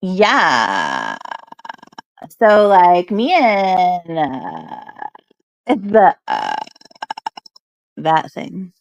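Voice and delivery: vocal fry impression. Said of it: "she's like".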